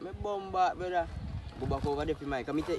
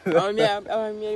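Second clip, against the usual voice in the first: normal talking voice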